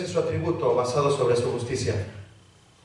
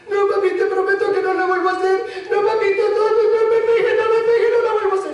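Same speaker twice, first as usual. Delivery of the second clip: put-on high, loud, whiny child begging; pleading child; 5 dB louder, faster than usual. Preacher mimics a child begging his dad not to hit, high wailing plea. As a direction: beg like a scared little kid. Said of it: "cuando el niño empieza a llorar".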